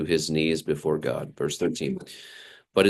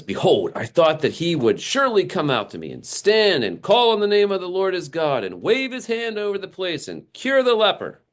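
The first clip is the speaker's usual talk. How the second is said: high, indignant rant of a proud general; a slighted commander ranting in outrage; ranting Naaman; 11 semitones higher than usual. Acted. Naaman ranting at being snubbed by the prophet, high and indignant. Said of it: "he continues his rant".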